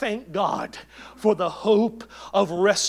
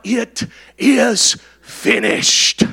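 loud, slow proclamation, every word drawn out; cried out; 10 dB louder, much slower than usual. Retold or imitated. Pastor quoting Jesus's cry on the cross, slow loud proclamation. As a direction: call it out slowly and loudly, like a final cry. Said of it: "He cried out".

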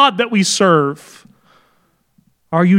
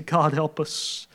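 hushed prayer; 4 semitones lower, 12 dB quieter, slower than usual. Real, not acted.

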